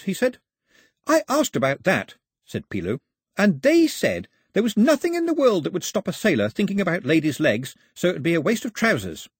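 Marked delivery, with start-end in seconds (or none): 1.1-2.2 high, animated explainer; lively Pilou. Acted. Pilou translates the song for Mao, high and eager. 3.4-9.3 high, animated explainer; lively Pilou. Acted. Pilou translates the song for Mao, high and eager.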